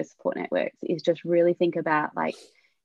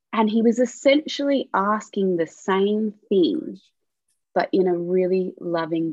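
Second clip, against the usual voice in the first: usual speaking voice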